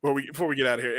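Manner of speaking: usual speaking voice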